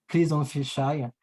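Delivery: normal talking voice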